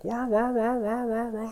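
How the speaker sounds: high, repeated droning "wah"; imitated trainer whir. Mechanic describing all the trainers going, high imitated whir. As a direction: drone like bike trainers spinning. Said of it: "so it's just like".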